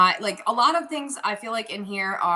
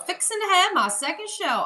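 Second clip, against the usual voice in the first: put-on Southern accent; 10 semitones higher than usual. Seller reading a newcomer's comment aloud, in a Southern accent. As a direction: read it like a Southerner, pitched up. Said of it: "oh, you Southern".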